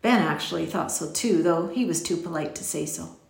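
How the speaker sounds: plain reading voice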